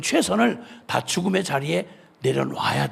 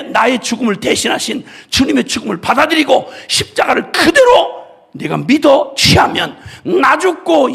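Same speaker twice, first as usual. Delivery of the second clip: tearful preaching; 6 semitones higher, 7 dB louder than usual. Real, not acted. Preacher cries out mid-sermon.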